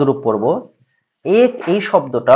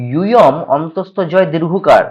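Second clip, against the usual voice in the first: normal talking voice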